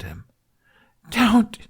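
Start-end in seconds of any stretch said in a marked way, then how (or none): 1.1-1.6 high, louder breathless cry; gasped plea. Acted. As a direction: gasp it out in terror. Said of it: "Hiccup gasped".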